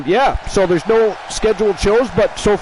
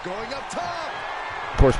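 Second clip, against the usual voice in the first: sudden shout; 8 semitones higher, 8 dB quieter than usual. Real, not acted. Sudden loud outburst.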